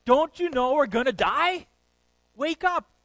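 high, loud desperate yelling; frantic shout. Retold or imitated. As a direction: shake him awake, shouting. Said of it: "they wake up Jesus and saying".

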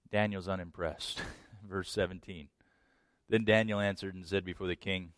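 ordinary delivery

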